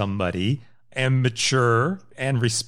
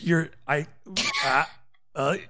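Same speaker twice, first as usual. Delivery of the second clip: fake bad connection; 5 semitones higher than usual. Fakes bad line to hang up.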